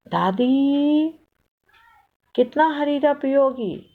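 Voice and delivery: drawn-out high call, then chiding; scolding grandmother. A narrator's reading. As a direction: stop her with a long, high-pitched reproach. Child scolds grandmother, stretched call. (दादी को टोकता हुआ खिंचा, ऊँचा स्वर)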